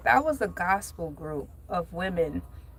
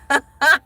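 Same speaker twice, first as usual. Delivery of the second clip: loud real cackle; laughing; 4 semitones higher, 11 dB louder than usual. Real, not acted. Host cracking up at her Target impression, loud laughing. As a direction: burst out laughing hard.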